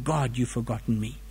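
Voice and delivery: a lonely complaint breathed out; hushed lament. Acted. Voicing the psalmist's abandonment.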